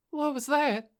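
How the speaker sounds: high, shaken question; trembling. Acted. Girl asking the driver after the crash, trembling. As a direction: ask it scared, voice up.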